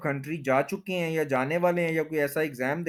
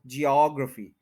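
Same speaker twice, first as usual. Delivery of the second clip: Geography as jee-AWG-ruh-fee; stress on OG. Shown as a demonstration. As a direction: hit AWG in geography, then reduce "ruh". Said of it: "ओ जी पे stress है".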